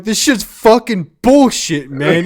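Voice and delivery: loud, high imitation of a furious gamer rant; mock rage, drawn out and shouted; imitating mad friend. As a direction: yell it like your friend raging at a game. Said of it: "You got so fucking mad".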